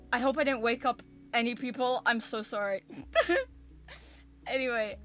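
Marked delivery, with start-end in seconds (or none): 2.9-3.6 high giggle. Real, not acted. Embarrassed laugh.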